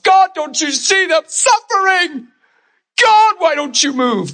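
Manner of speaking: a spouse crying out to God, slow and high; pleading prayer. Pretend. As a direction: beg God slowly, voice lifted high. Imagined pleading prayer of worried spouse.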